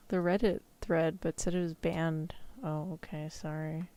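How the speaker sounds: normal talking voice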